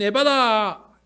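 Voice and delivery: big ringing shout, drawn out; loud cry. Acted. Preacher voicing Jesus's prayer to congregation, loud cry. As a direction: call out Ephphatha, high and very loud.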